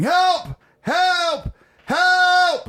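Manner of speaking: high, loud mock screams for help; fake screams for help. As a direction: yell for help to scare dad. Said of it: "I started screaming".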